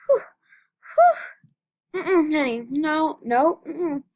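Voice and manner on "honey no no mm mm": usual speaking voice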